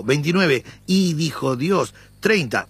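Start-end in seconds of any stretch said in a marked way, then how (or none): 0.9-1.9 "Y" stressed and slowed. Shown as a demonstration. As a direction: lean on the "Y", read slowly. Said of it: "Yo voy a darle énfasis en la "y". (la "Y" enfatizada y lenta)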